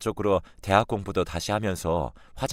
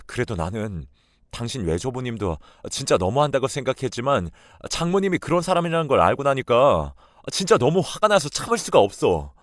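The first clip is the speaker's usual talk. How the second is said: louder and higher, demanding answers; angry husband; 4 semitones higher, 4 dB louder than usual. Acted. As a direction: hurl the question at her in disbelief. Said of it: "불같이 화를 내며". (아내에게 따지는 남편의 성난 목소리)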